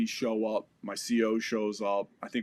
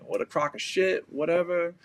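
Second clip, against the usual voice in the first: self-pity; 5 semitones higher than usual. Retold or imitated. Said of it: "I started feeling sorry for myself".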